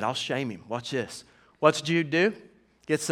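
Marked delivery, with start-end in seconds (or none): 0.0-1.2 much softer, lower conspiratorial aside; sly coach aside. Retold or imitated. Coach quietly telling dad his plan. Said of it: "Coach P said".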